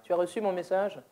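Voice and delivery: softly asked question. Acted. Hearing person asking the deaf woman about a message, softly. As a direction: ask it gently, almost under the breath. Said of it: "imaginez cette situation-là".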